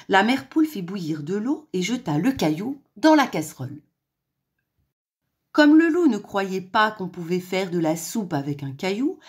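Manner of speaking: plain reading voice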